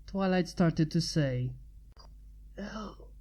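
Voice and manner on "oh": a faint, much softer mumble; mumbled oh from a wounded man. Acted. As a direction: barely get the word out, weak. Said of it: "he mumbled".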